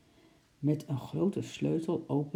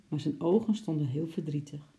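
ordinary delivery, nothing marked

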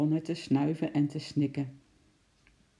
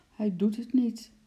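higher, glum little mumble; disheartened mumble; 6 semitones higher than usual. A narrator's reading. Henkie losing hope in the monkey, mumbling. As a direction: mumble it, close to tears. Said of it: "mompelde Henkie".

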